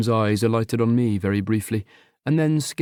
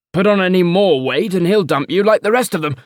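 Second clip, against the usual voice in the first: much higher and louder, blokey ribbing; an uncle guffawing at his own joke; jeering uncle; 7 semitones higher, 7 dB louder than usual. Acted. Uncle teasing his nephew, loud and high.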